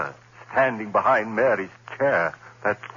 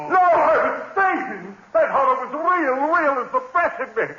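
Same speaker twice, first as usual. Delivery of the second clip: desperate denial. Acted. Man insisting to himself he is sane, frantic cry. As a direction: fight off the madness.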